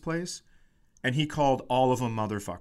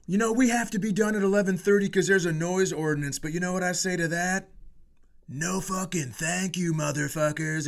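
higher, hyped rock frontman yell; rock star stage shout; 5 semitones higher than usual. Retold or imitated. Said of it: "And he goes".

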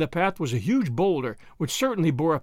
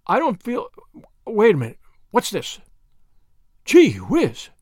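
louder, higher burst of surprise; startled discovery; 3 semitones higher, 5 dB louder than usual. Acted. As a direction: break off, then exclaim at the find. Finds hidden packet mid-sentence.

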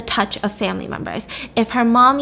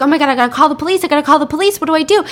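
fast, higher-pitched retold panic; freaking out; 4 semitones higher, 4 dB louder, much faster than usual. Neighbor who just saw blood, freaking out. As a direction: blurt it in a mock fluster, racing. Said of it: "starts freaking out".

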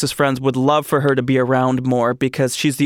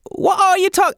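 a much higher, squeaky Cockney grandma that breaks off; Cockney accent attempt. Host reading a listener's story, attempting a Cockney grandma. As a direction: try a Cockney grandma and give up. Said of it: "in her very Cockney accent".

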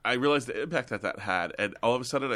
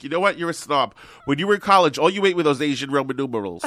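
high, loud comic mother telling someone off; scolding Italian mom; 6 semitones higher, 9 dB louder than usual. Acted. Mom calling her son a snob, scolding. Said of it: "She's like".

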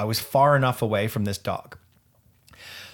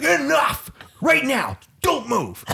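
high, slow shouted command; stern dad voice; 13 semitones higher, slower than usual. Retold or imitated. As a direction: order the dog to freeze. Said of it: "is my dad voice".

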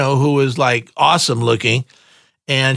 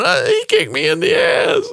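high, hammed-up crying shout; mock sobbing complaint walking home; mock wail. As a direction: whine it like a hurt kid. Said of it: "walking home going".

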